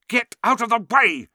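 high, loud angry shout; snapping. A narrator's reading. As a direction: snap at him furiously. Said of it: "snapped Ron".

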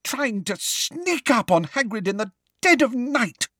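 high-pitched, spluttering indignation; choked with rage. A narrator's reading. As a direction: force it out through fury, words catching. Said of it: "having difficulty talking due to rage".

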